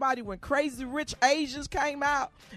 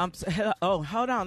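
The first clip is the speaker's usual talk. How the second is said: out of breath from squeezing into a shirt; puffing between words; 3 semitones lower than usual. Real, not acted. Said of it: "Let me catch my breath".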